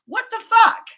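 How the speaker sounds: sharp high cry of disbelief; exasperated outburst. Real, not acted. Upset about her body, a loud exasperated cry.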